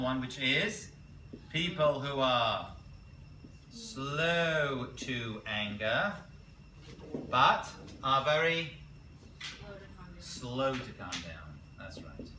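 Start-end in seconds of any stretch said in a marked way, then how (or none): 1.5-11.6 slow dictation. Shown as a demonstration. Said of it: "Write these down".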